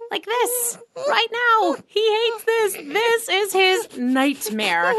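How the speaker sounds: gleeful teasing. Real, not acted. Player teasing shy Daniel, high gleeful voice. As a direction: pile on the shy friend with glee.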